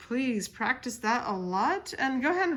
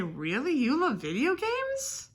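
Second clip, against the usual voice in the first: incredulous question, swooping up high; demonstrated disbelief with a big rising lilt; surprised; 5 semitones higher than usual. Acted. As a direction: act surprised, pitch climbing steeply on the question. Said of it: "And you're surprised".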